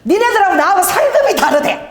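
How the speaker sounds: high, loud mock bragging; comic boast, pitched well up; boasting mother-in-law. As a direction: imitate a mother-in-law crowing to church ladies. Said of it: "우리 어머니가".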